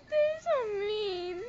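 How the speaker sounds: high, drawn-out tearful wail; crying complaint. Real, not acted. Vlogger lamenting mean haters, crying. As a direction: wail it slowly through tears.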